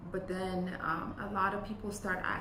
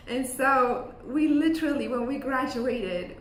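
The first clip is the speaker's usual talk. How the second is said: laughing; 6 semitones higher, 10 dB louder than usual. Real, not acted. Laughing through funny story.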